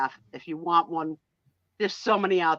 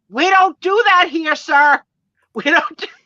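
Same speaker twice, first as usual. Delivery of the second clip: imitating a flustered aunt's loud, high protest; high, loud mock freak-out; freaked-out scolding; 9 semitones higher, 10 dB louder than usual. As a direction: scold loudly in a raised pitch, retelling her outrage. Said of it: "freaked out".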